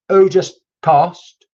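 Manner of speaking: slow, loud call to the spirits; Who just passed, drawn out and loud. Real, not acted. Medium calls out to the spirits, slow and loud.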